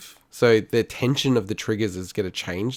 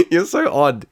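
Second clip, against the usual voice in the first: laughing while teasing; 7 semitones higher, 7 dB louder, slower than usual. Real, not acted. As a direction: say it through a laugh. Laughing at partner.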